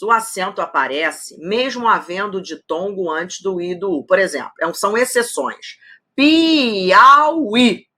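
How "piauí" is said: "Piauí" stretched, high and loud, pi-au-í; syllable by syllable. Shown as a demonstration. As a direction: draw out "Piauí" up to a clear final í. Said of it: "tem acento aqui nesse i".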